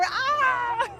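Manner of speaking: high, loud yelp of fright; startled shriek. Real, not acted. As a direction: shriek with a laugh. Dinosaur bites at the arm.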